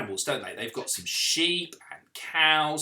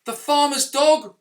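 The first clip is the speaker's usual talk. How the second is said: plain reading voice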